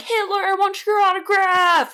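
high, squealing fan voice; hammed-up adoring fan; mock fan gush. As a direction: gush like a starstruck crowd. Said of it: "everyone's like".

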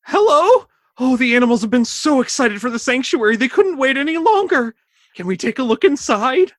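bright, high cartoon vet voice; cheerful high character voice. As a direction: play the eager animal doctor. Voicing Doctor Fauna.

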